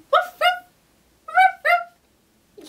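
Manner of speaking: very high, short little woofs; dog bark. Shown as a demonstration. Teacher answers what sound a dog makes, dog bark. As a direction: yap like a small dog. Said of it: "What sound does a dog make?".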